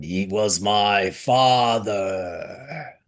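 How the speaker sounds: slow, low menacing villain voice; Darth Vader. Retold or imitated. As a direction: drag it out like a masked villain. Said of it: "a little bit of a Darth Vader thing going on".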